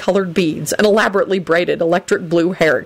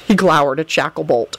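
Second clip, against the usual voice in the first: ordinary delivery, nothing marked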